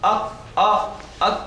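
أك cut off with a jerky catch; wrong clipped ak. Shown as a demonstration. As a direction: chop the أك short and broken. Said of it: "ما تقولش أك".

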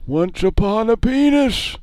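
put-on high, slow old man storybook voice; Grandpa voice. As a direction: drag it out like a squeaky grandpa. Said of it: "you were doing Grandpa before".

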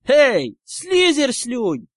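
high, loud drawn-out insult; shout. A narrator's reading. Ernie insulting the Slytherin, shouting. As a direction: yell it with stretched words. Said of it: "закричал Эрни".

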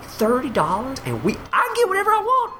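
high, eager put-on customer; excited diner. Steakhouse customers who can get anything, high and excited. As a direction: gush in a mock greedy voice. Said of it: "They're like".

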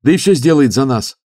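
high, loud zealous youth; eager young monk. Acted. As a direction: lift the voice, earnest and keen. Youth continues, fired up.

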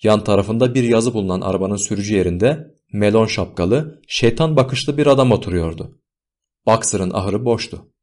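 plain reading voice

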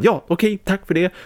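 high-pitched, eager-to-obey voice; compliant yes-man. Acted. Acts Carl obeying once more.

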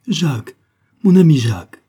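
high, loud cry of the name; breaking out in joy; overjoyed greeting. A narrator's reading. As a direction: call to your returning love. Young woman greets returning lover.

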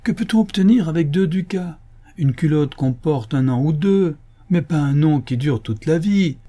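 high-pitched indignant tirade; scornful exclamation. A narrator's reading. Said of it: "s'écria le chancelier". (tirade indignée, voix haute)

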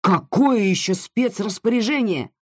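exploding in anger. Acted. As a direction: burst out, a touch of fury. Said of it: "взорвался Сикорский".

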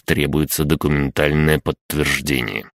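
usual speaking voice

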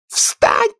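piercing, very high one-word command; loud piercing shout. A narrator's reading. Queen orders the gardeners up with a piercing shout. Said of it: "громким пронзительным голосом".

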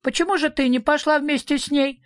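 high, indignant small-animal voice; small creature's high protest; indignant little creature. A narrator's reading. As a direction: demand it indignantly, pitched right up. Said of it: "возмущённо спросил зверок Шнырок".

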